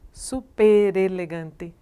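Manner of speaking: "Super elegante" very slow as su-pe-re-legante, no break after r; joined syllable by syllable. Shown as a demonstration. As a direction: stretch "Super elegante", letting r open the next syllable. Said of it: "a gente fala tudo junto".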